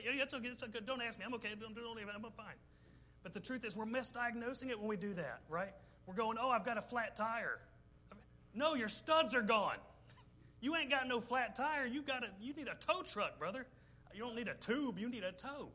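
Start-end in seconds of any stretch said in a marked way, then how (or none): 0.0-2.5 put-on high, hurried brush-off of a kid insisting he's fine; a boy waving off concern, pitched up; mimicking Carter brushing off his parent, pitched up; imitating upset son. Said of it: "Carter does that when he gets upset". 6.0-15.7 loud, high, punchy sermon climax; shouting the point to the congregation; fired-up preaching. Real, not acted. Fired-up preaching about tire metaphor.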